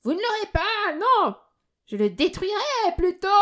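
very high, frantic delirious screech; high-pitched cry. A narrator's reading. Delirious miser defends his banknote, screeching high. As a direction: screech your defiance far up high. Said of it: "criait-il d'une voix aiguë".

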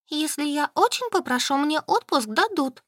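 high-pitched pouting voice; sulky. A narrator's reading. Solka insists she can get leave, sulkily. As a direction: pout it, pitched well up. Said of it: "надулась Солька".

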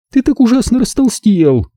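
very high, mildly grieving woman; woeful lament. A narrator's reading. Zelda bemoans her ex-husband's weight, mildly woeful. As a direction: lament with a touch of theatrical woe. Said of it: "горестно вздохнула Зельда".

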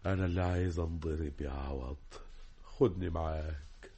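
soft, low, broken plea; defeated pleading. Acted. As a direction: beg quietly, spent. Broken man begs to be taken. (رجاء خافت منكسر)

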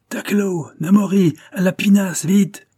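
high, loud call to the crew; shouted orders. A narrator's reading. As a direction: bellow the sailors to the boat, urgently. (le skipper crie ses ordres à l'équipage, fort et aigu)

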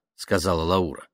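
ordinary delivery

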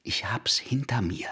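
unvoiced whispered confession. Sachar whispers his confession to Dima at the door. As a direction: breathe it out as a hushed whisper.

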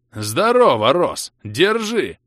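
loud, high, gleeful holler; joyful greeting. A narrator's reading. Vlas greeting his old friend as he swings, joyful shout. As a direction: greet with boisterous delight. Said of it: "Радостно поприветствовал меня Влас".

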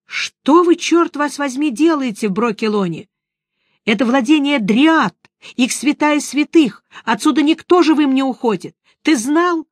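loud, fierce and high-pitched scolding; angry shout. Acted. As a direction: bark it at a reckless friend. Witcher scolds wounded friend. (гневный окрик)

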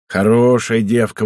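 usual speaking voice

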